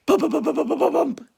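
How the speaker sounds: plain reading voice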